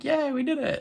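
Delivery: high mocking yay; mock cheer. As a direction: play the clueless guy celebrating too soon. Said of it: "he's all like".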